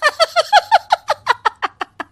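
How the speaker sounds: long, high evil cackle; witch's laugh. Acted. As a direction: cackle shrilly in a rapid descending run. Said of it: "उतने में एक हंसी की आवाज आती है". (चुड़ैल की डरावनी हँसी)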